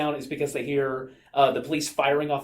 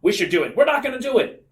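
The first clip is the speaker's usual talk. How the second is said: arguing; 9 semitones higher, 6 dB louder than usual. Acted. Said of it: "They would argue".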